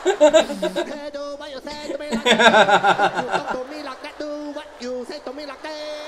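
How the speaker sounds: hammed-up Vietnamese accent, loud and fast; wired Vietnamese accent. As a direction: blast it out like you're on cocaine. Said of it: "sound like they've been doing cocaine".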